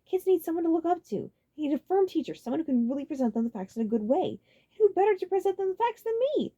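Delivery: high, loud, cocky boast; full of himself; confident Roy. A narrator's reading. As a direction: brag like the best teacher alive. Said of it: "with a confident expression".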